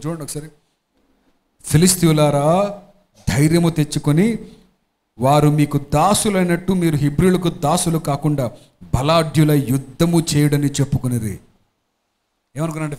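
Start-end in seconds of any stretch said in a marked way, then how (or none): 1.6-11.4 lower, louder, slow rallying reading; scripture reading. Acted. Pastor reading the Philistines' rally, low, loud and slow. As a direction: read it like a battle call, slow and firm. Pastor reads Bible passage aloud.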